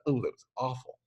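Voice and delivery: soft wincing ooh; remembered wince. Pretend. Recalling your own stubbed toe, soft rueful wince. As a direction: relive the sting of it.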